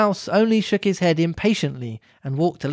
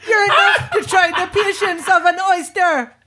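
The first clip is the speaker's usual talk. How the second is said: much higher, louder laughing Jamaican yell; young crab yelling at her mother in a Jamaican accent; snappish young crab; 13 semitones higher, 5 dB louder than usual. Acted. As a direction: shout it at ma, cracking up. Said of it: "a little snappishly".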